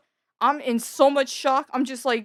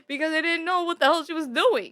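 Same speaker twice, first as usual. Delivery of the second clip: usual speaking voice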